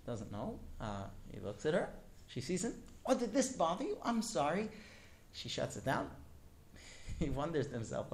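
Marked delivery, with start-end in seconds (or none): 3.1-4.7 mock-innocent, higher-pitched apology; lightly acted high "oh, sorry". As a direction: play the wife, surprised she disturbed him.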